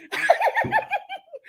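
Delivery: high burst of real laughter. Real, not acted. Cracking up while retelling the truck story, high laugh. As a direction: let the laugh spill out.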